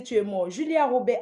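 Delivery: normal talking voice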